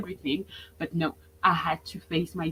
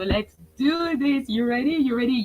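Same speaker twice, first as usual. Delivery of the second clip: rally the room to get ready; hype-up; 6 semitones higher than usual. Real, not acted. Coach firing up her webinar audience, excited.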